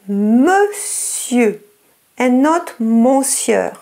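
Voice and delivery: ordinary delivery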